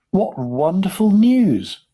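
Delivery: high, loud, drawn-out delight; joyful shout. A narrator's reading. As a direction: stretch it out slowly in a rising cheer. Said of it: "shouted Grandpa Joe".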